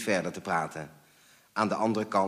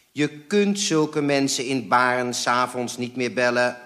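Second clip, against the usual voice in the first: louder, inexorable refusal; unrelenting; 3 semitones higher, 6 dB louder than usual. A narrator's reading. Father forbidding an evening phone call, unrelenting. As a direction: lay down the rule firmly. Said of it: "zei mijn vader onverbiddelijk".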